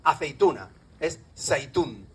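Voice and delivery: ordinary talk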